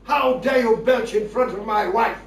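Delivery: loud, slow, outraged shout; indignant shout. Acted. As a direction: bellow each word slowly in outrage. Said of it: "He shouted at me".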